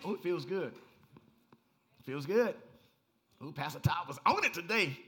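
a churchgoer raving after the service, mildly hammed-up; mock churchgoer. Mimics congregant after good sermon.

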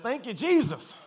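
over-the-top hallelujah shout; hammed-up praise shout. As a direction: scream your thanks to heaven. Comic praise for a lucky escape.

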